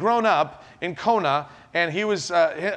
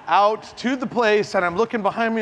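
ordinary talk

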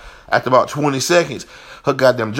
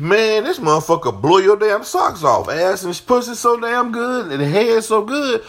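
imagined boast; 10 semitones higher than usual. Retold or imitated. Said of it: "had me thinking".

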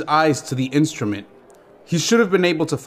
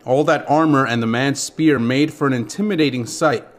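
ordinary delivery

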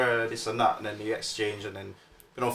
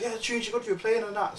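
higher, softer quoted compliment; imitated friendly woman; 9 semitones higher, 6 dB quieter than usual. Retelling a singer's compliment to the DJ, lightly imitated. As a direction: lightly imitate her, high and soft. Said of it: "And then she's like".

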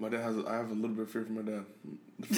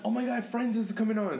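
higher, eager mock announcement; excited mom; 8 semitones higher than usual. Son imitating mom as Friends starts, excited. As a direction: imitate a mom thrilled her show is on.

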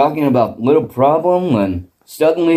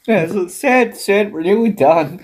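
mock crying; 7 semitones higher than usual. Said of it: "they start crying".

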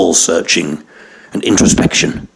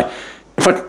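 put-on high, giggly, lost old captain; a dotty captain forgetting he flies the plane; confused captain; 10 semitones higher than usual. A passenger imagining a confused pilot on the intercom, high and giggly.

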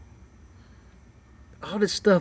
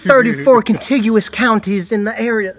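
higher, louder professional phone pitch; customer service voice; 8 semitones higher, 9 dB louder than usual. Retold or imitated. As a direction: rattle it off like a service rep. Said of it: "Customer service voice".

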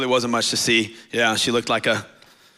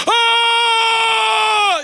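high, loud hammed-up sob-shout; mock crying wail; 8 dB louder than usual. Pastor acting out crying at Endgame, hammed-up high wail. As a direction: wail like a movie fan losing it.

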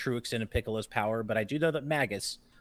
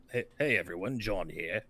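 attempted deep, lower host greeting; John impression; 6 semitones lower, 4 dB quieter than usual. Co-host trying John's show intro, badly faked deep voice. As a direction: strain for a low voice you can't quite reach. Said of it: "can't even get my voice down that low".

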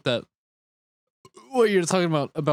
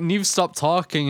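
normal talking voice

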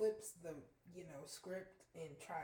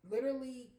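"Literally" punched louder and higher; emphatic; 6 semitones higher, 10 dB louder than usual. Real, not acted. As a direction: hit "Literally" hard, high and loud. Emphatic repeat.

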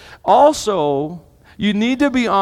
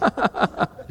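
short chuckle; 4 semitones higher, 5 dB quieter than usual. Real, not acted. Laughing with his flustered wife.

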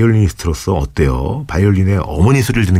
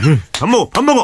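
put-on calling kids to dinner; 11 semitones higher than usual. Mock mother calling for dinner.

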